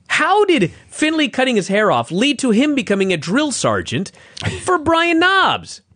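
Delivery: incredulous rant climbing high; exasperated anger. Real, not acted. Podcaster baffled by the drill sergeant storyline, exasperated. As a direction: build to a high-pitched, baffled outburst. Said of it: "Why did this make me so mad?".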